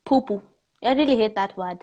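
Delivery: normal talking voice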